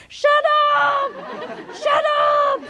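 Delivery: very high drawn-out screech; long, fed-up shut up. Shown as a demonstration. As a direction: screech it, stretching the UP. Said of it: "if it take too long and too noisy".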